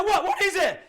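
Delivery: high, loud alarmed question; jolted awake and worried; startled question. Pretend. Partner startled in imagined scene.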